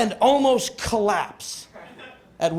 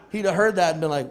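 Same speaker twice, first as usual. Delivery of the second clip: ordinary talk, nothing marked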